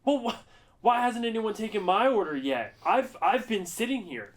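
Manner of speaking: very loud, high hammed-up complaint; imitated outraged shouting. Staffer re-enacting a diner's complaint, outraged shouting. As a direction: holler it as an impatient diner.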